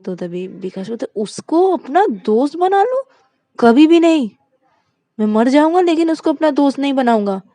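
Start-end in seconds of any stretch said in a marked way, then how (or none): 1.1-7.4 much higher, loud, indignant shout; outraged refusal. Acted. Vikas to Shruti, refusing to befriend Rani, outraged shout.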